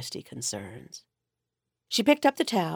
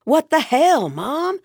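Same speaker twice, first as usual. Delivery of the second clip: louder, higher, exasperated; exasperated outburst; 8 semitones higher, 6 dB louder than usual. Acted. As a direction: blurt it out, caught off guard. Son caught by his mother.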